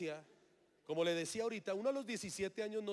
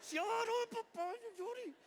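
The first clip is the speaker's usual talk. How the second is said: much higher, strained, teeth-gritted self-scolding; choked-up high voice telling himself not to cry; holding back tears; slower than usual. Retold or imitated. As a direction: fight off tears through clenched teeth, slowing at the end. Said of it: "yo apretaba los dientes diciendo".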